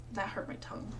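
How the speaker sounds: ordinary delivery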